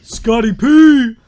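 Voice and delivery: slow, loud drawn-out shout; excited shout. Pretend. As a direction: belt out the name. Hyping the film title.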